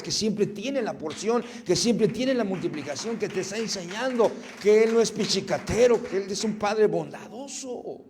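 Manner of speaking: loud, high, rising preacher's proclamation; fiery preaching. Acted. Pastor proclaiming abundance to the congregation, loud preaching. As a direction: proclaim it like a revival pulpit.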